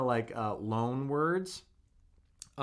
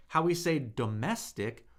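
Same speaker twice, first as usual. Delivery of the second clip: ordinary delivery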